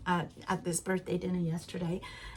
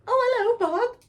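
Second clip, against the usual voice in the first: high, loud, bright hello to her husband; delighted greeting; 14 semitones higher, 6 dB louder than usual. Real, not acted. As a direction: come and say hello, sing it out.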